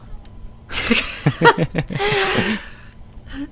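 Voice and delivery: squeaky high-pitched giggle. Real, not acted. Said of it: "would you mind showing it".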